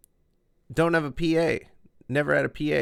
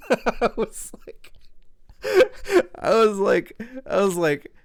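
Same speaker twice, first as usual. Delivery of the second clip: laughing while talking; 10 semitones higher, 5 dB louder than usual. Real, not acted. Cracking up retelling gauges comment.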